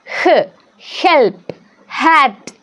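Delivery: ordinary delivery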